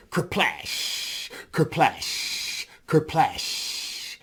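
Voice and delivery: kerplash with a long hissing tail; splash noise. A narrator's reading. Storyteller imitating Brer Whale hitting the water, whooshing splash sound.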